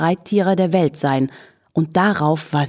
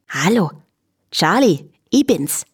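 chirpy Viennese hello; greeting flea. A narrator's reading. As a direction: announce yourself in little lilting bursts. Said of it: "grüßte der Floh".

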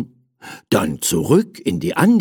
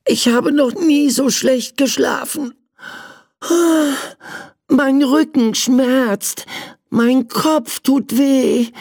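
sore little prince; 12 semitones higher than usual. Acted. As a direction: moan about an aching back. Prince complains of bad sleep.

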